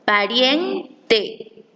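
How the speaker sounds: Pariente with rien stretched out long; Pariente, slow, holding rien before a detached te; lengthened stressed syllable. Shown as a demonstration. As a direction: draw out the middle of Pariente: pa-rieeen-te. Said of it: "existe una mayor duración".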